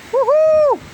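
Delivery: high whoop of delight; joyful whoop. Real, not acted. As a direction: cheer at the rain. Celebrating the rain.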